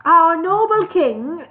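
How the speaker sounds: high, slow formal dwarf herald. Acted. Dwarf addressing the elf lord, high and slow. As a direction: announce the king grandly, slowly.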